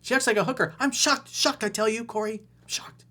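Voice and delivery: high, loud mock gasp of shock. Coach mocks the caller's shocked email. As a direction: ham up being scandalized.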